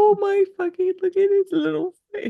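squealing high, crying with delight; overwhelmed by cuteness. Real, not acted. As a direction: melt over the cute little face. Gushing over the sleepy dog.

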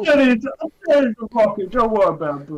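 a lightly put-on New York accent; a hint of New York tough guy. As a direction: ham up a tough Brooklyn guy. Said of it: "That's the accent you're trying to do".

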